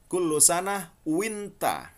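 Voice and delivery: "kullu sanah winta" slow and high, "kulo" and "winta"; Saudi pronunciation. Shown as a demonstration. Teacher reading the congratulation the Saudi way. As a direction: say "winta" where Fusha says "wa anta". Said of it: "Yang amiyah Saudi dibaca".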